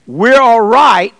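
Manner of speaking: high, loud, sing-song all right; mock the comfortable churchgoer; mock reassurance. Preacher mocking false comfort, high and loud.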